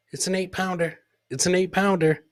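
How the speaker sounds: ordinary talk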